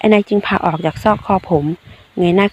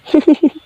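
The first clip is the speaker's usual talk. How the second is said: a quick high burst of laughter; a sudden giggle you can't hold back; bright snort of a laugh; short laugh; 8 semitones higher than usual. Real, not acted. Laughing at his messy look.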